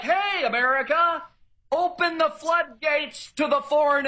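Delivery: high, mocking open-arms welcome. Ranting vlogger mocks America welcoming foreigners, high mocking voice. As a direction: sneer an open invitation to everyone. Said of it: "But we're like".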